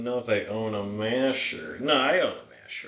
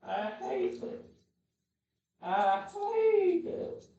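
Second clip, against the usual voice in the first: whiny dog voice; 2 semitones higher, slower than usual. Acted. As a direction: speak for the dog, whining. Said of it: "Oh, now you have to go".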